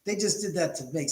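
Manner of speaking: normal talking voice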